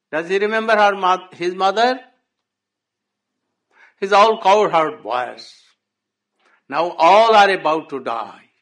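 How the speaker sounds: loud, grieving father's plea; anguished outcry. Acted. Nanda Baba crying out to Uddhav about Krishna, loud and grieving. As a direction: cry out the pain of being forgotten.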